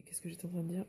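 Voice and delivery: whispered to herself; breathy whisper, thinking aloud. Real, not acted. Loses her thread, mutters to herself.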